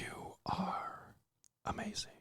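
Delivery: whispered affirmation, ASMR style. Pretend. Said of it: "Are you doing A S M R a-, as-, affirmations?".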